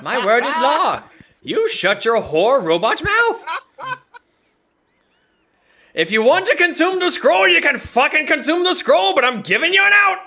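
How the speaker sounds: roaring, high and loud tirade; roaring tyrant king. Acted. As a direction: bellow down like a tyrant. DM rants at player.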